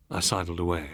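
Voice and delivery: ordinary delivery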